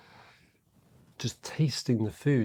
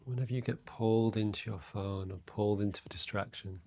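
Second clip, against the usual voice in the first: guided meditation; 6 dB quieter than usual. Real, not acted. Meditation teacher to listeners settling into their breath, soft and calm. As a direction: guide them inward quietly, leaving space between phrases.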